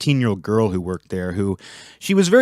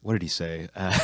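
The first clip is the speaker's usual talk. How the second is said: usual speaking voice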